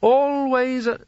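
very slow, high, delighted; happy sultan. Acted. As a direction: beam with pride over grandad. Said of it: "said Creosote happily".

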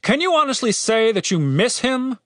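high-pitched challenge; challenging leader. A narrator's reading. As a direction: press the underling for an honest answer. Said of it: "replied the leader".

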